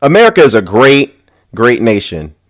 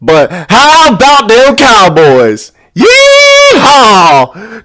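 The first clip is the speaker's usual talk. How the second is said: high, drawn-out mock cowboy holler; gleeful Texan cowboy whoop; whooping cowboy accent; 7 semitones higher, 4 dB louder, much slower than usual. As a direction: holler it like a cowboy, ending in a big yeehaw. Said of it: "why I got this accent".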